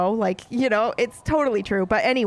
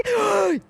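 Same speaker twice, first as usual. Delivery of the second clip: high, sharp mock-outraged gasp; shocked gasp; 9 semitones higher than usual. Reader reacting to the last page, scandalized gasp.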